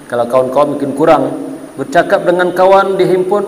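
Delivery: ordinary delivery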